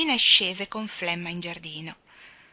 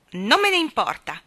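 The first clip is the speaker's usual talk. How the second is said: louder, sullen reply; sulky retort; 4 semitones higher, 8 dB louder than usual. Acted. Jane sulking back at her nanny, defiant. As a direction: mutter it defiantly.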